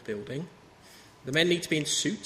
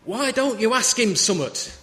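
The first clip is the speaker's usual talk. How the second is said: a northern lady's loud, slow stage whisper, higher and louder; 7 semitones higher, 7 dB louder than usual. Retold or imitated. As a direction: hiss it across the room like a fed-up northern grandma. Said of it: "in a big stage whisper she said".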